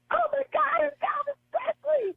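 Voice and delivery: very high, shrill shriek of a star-struck fan. Retold or imitated. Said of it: "this one lady started shrieking".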